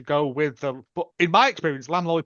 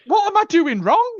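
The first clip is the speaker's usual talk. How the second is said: high indignant singer; 14 semitones higher, 7 dB louder than usual. Retold or imitated.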